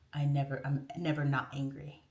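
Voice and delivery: low, understated confession; Hulk. Retold or imitated. The Hulk explaining how he controls it, low-voiced. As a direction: say it low, as a hero admitting his secret. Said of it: "It's kinda like the Hulk".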